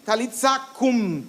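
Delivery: "Talitza cum" with a ts sound and the final u barely heard; "Talitza cum" in Aramaic pronunciation, the u faint. Shown as a demonstration. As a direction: say "Talitza cum" as tsa, the u almost swallowed. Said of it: "se pronuncia algo así".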